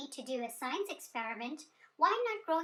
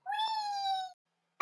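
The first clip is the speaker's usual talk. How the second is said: squealed high with delight; a high, delighted whoop while flying up; gleeful whee. Acted. Fairy flying up squeals with glee.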